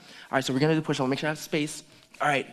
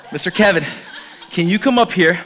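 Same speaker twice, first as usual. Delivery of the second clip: louder call, laughing through it; louder laughing repeat of the invite; 7 dB louder than usual. Real, not acted. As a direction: call him up again with a laugh.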